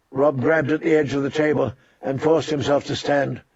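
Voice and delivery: ordinary delivery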